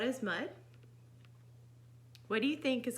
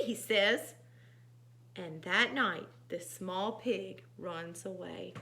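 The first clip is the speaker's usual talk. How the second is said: animated reading with raised character lines; expressive storytime voice; story narration; 3 semitones higher, 7 dB louder than usual. A narrator's reading. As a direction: read to children, lifting the dialogue. Reads picture book aloud.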